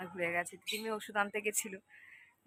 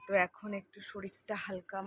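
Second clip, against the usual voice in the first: usual speaking voice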